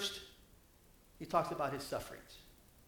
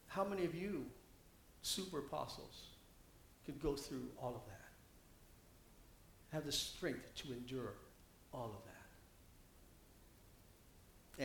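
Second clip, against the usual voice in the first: hushed challenge; 4 semitones lower, 14 dB quieter than usual. Acted. Preacher voices Paul's quiet challenge.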